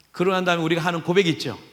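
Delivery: ordinary delivery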